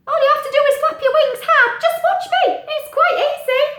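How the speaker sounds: high, loud chirpy bird voice; little bird voice. Acted. Little bird greeting Pete, high and loud. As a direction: squeak it out like a tiny bird. Steve teaches flapping.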